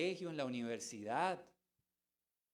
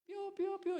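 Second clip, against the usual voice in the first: very high squeaky chick peeps; mocking complainers as peeping chicks; faster than usual. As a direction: peep like a boxful of chicks. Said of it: "Parece una caja de pollos".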